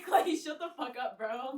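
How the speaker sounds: loud, drawn-out joking shout at a coworker; mock exasperated retort. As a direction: playfully yell down a teasing coworker. Acts out retort to coworker again.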